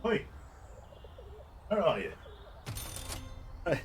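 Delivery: usual speaking voice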